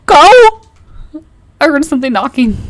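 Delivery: high, loud frightened yell; panicked shout. Real, not acted. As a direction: scream it while fleeing. Running from monster.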